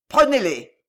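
sudden forceful battle cry; attack order. A narrator's reading. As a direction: call out the order to charge.

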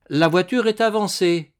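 formal servant calling out; servant's announcement. A narrator's reading. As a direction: announce it to the room. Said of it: "annonça bientôt un domestique".

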